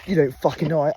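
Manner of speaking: playful mock aggression; mock threat. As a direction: taunt the cows like a pub brawler. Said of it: "I went".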